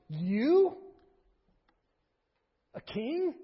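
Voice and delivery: "You?" loud, then "A king?" climbing high in disbelief; emphatic you. Shown as a demonstration. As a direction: scoff at the very idea he's a king. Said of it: "that pronoun there is emphatic".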